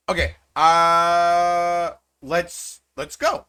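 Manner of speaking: usual speaking voice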